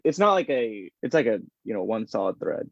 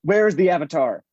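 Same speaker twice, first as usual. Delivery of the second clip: demanding, louder and higher mock prince; hammed-up impatient demand from an obsessed hunter; Zuko; 5 semitones higher, 5 dB louder than usual. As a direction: imitate an intense cartoon villain demanding answers. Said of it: "he says this line".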